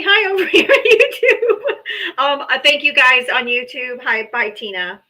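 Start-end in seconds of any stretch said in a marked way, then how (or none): 0.0-1.6 laughing hello. Real, not acted. Greets YouTube viewers.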